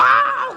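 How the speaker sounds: sudden high mock scream; imitated scream. Ustadz imitating the dying man's shriek at the talqin, mock scream. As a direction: yelp out the dying man's cry. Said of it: "teriak sekeras-kerasnya".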